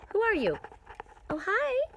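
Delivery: high sing-song hello to a bird; high bird greeting. Real, not acted. Speaking to the mockingjay outside, high and sweet. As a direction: coo up high at the little bird.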